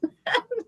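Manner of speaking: high laugh tipping into a cry; tearful high laugh; laugh breaking toward tears. Real, not acted. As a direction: laugh through welling emotion. Emotional laugh, near tears.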